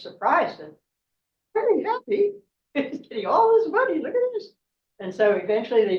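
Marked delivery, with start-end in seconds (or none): 1.5-4.5 much higher, laughing with glee; delighted. Acted. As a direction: squeal with joy over the money. Boy showered with money, delighted.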